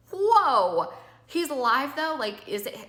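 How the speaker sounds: a loud, high burst of alarm; shocked. Retold or imitated. Telling investigators her dad is alive, shocked and loud. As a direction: blurt it out in disbelief. Told father killed someone, shocked.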